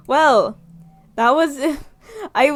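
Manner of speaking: ordinary delivery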